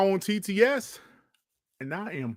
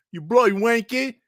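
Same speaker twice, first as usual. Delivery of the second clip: badly faked English accent, pitched up; mock-British insult in a poor English accent; 6 semitones higher, 4 dB louder than usual. Streamer playfully swearing at the chat, hammed-up bad English accent. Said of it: "My bad English accent".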